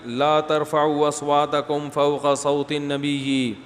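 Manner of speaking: Quranic recitation. Acted. Quoting Quranic verse.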